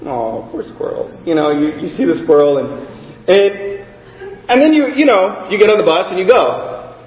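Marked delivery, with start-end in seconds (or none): 0.1-1.1 low, soft murmur of pity; pitying sigh. Retold or imitated. Commuter pitying the roadkill squirrel, low soft murmur. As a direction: mutter it sadly to yourself.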